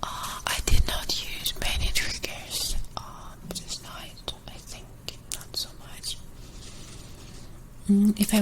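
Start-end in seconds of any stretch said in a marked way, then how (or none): 0.0-6.2 ASMR whisper. Acted. ASMR creator whispering to viewers while working, hushed whisper. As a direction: breathe the words to the viewer.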